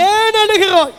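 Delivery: loud tender question to his weeping wife; husband asking. Acted. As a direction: plead like a worried husband. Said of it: "இப்படி ஒரு கேள்வி கேட்கிறார்".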